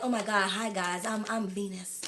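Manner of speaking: low, soft mock American greeting; imagined American accent. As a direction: introduce yourself in a playful, deep American accent. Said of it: "Like, imagine, I'm like".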